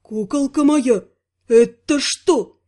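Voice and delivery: slow, voiced murmur of horror; horrified murmur. A narrator's reading. Couturier aghast at what the heroine is wearing, horrified. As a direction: drag it out in shocked horror. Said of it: "прозвучал исполненный ужаса шёпот".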